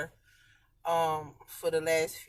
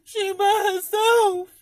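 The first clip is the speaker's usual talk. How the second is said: high, loud tearful wail, slow; sobbing out the loneliness; real tears, voice high and strained; crying; 13 semitones higher, 10 dB louder, slower than usual. Real, not acted.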